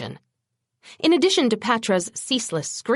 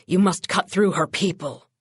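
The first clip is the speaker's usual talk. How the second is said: raspy; 2 semitones lower than usual. Acted. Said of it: "he rasped to Bones".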